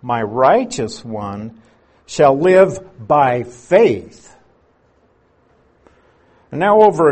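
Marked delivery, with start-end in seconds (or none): 0.0-4.4 slow and emphatic, building louder toward "faith"; slow down and land hard on "faith"; emphasis on faith. Shown as a demonstration. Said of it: "emphasis there in Hebrews is on the word faith".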